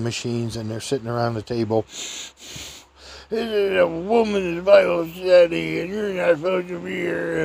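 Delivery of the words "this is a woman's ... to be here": prim lady voice. Retold or imitated. Church women telling him he shouldn't be at Bible study. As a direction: put on a prissy reprimanding woman. Women reprimanding early arrival.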